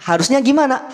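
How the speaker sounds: ordinary talk, nothing marked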